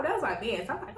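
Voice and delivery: ordinary delivery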